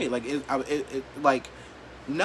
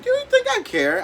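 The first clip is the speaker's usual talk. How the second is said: mocking high squeal of disbelief; scoffing squeal; 6 dB louder than usual. Real, not acted. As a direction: pitch jumps way up, louder and scornful. Scoffing at losing followers.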